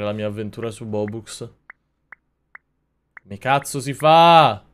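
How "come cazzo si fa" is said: slow, stretched-out exasperated ask; exasperated question. Real, not acted. Streamer asking his chat, fed up and slow. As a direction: drag it out, fed up with the game. Frustrated at game, asks chat.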